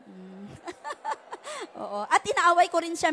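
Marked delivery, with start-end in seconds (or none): 0.5-1.8 light laugh. Real, not acted. Laughing after joke.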